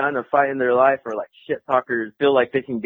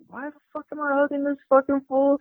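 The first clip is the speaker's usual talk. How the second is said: higher, disbelieving mutter to himself; wondering why he's hugging the guy; incredulous inner voice; 11 semitones higher, 3 dB quieter than usual. Retold or imitated. Mid-hug, he asks himself in a high incredulous voice. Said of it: "and I'm just like".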